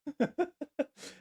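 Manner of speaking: high squeaky burst of laughter; excited high laugh. Real, not acted. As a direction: giggle high and breathy. Excited over the AI song.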